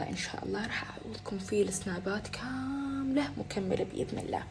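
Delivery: soft unvoiced whisper. Real, not acted. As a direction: breathe it as a secret. Whispered promise to followers.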